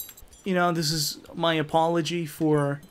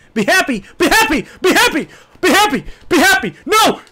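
starts mildly raised, climbs into a high loud yell; escalating shout; 13 semitones higher, 10 dB louder than usual. Acted. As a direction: repeat it, louder and higher each time until screaming. Repeating order, escalating to scream.

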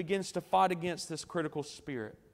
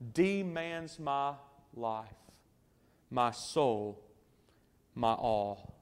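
soft hymn quote; 6 semitones lower, 7 dB quieter, slower than usual. Acted. Quoting hymn lyrics softly.

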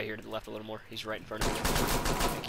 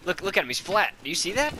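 higher, louder, amazed; excited; 8 semitones higher, 8 dB louder than usual. Real, not acted. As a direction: point it out with glee. Excited at glitched enemy.

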